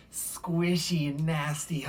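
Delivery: slow, drawn-out squishy words; slowly savoring the yuck; play-acted squeamishness. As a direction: stretch it out as if wading through muck. Acts out wading through soup.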